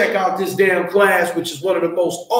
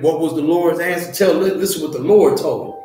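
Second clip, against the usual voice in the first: normal talking voice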